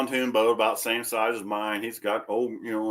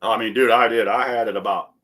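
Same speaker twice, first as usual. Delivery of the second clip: ordinary talk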